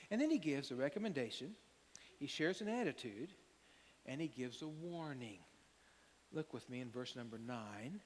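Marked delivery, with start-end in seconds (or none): none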